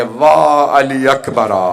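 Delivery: loud, long-drawn wail of lament; mourning cry. Retold or imitated. Mother calling out for her slain son, loud drawn-out lament. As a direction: cry the name out slowly in imitated grief. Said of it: "याद करके रो रही हैं".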